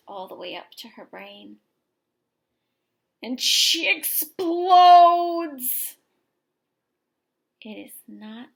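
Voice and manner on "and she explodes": very loud, drawn-out explosive line; loud, slow eruption; explosive shout. A narrator's reading. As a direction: blow up on the word explodes. Girl's temper explodes.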